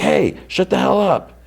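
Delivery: high, strained mock yell; retold yell. Jonathan cutting off the argument, retold as a mock yell. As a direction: imitate someone yelling to silence a squabble. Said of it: "Jonathan yells again".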